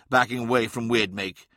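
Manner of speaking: ordinary delivery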